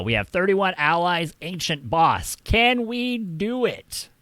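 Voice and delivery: high, loud game-show buildup; rally the stream for a boss fight; hyped battle announcer. Real, not acted. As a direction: build to a high, drawn-out challenge.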